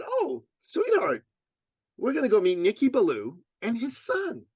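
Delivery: high, lilting mommy voice; mother to child. Acted. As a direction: answer a little son with a big sweet reveal. Mother answering her little son.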